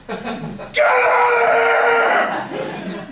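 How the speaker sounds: high screaming at the top of the lungs. Retold or imitated. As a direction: scream them off your property. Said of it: "yelling at this kid".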